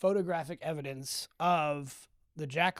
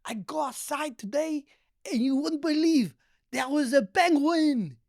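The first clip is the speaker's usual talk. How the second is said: much higher, hammed-up French accent with laughter; French grandma accent; 11 semitones higher, 5 dB louder than usual. Grandma phoning her grandson with a prank, in a French accent. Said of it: "She was French".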